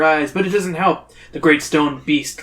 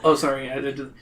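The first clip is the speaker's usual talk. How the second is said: ordinary talk, nothing marked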